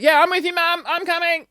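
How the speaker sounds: high, quick "I'm with you, Mum"; eager agreement. Retold or imitated. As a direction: jump at the chance to leave. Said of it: "And I'm like".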